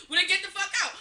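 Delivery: high, loud mock yell; retold angry outburst. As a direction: act out a driver ordering someone out. Acted-out driver throwing her out.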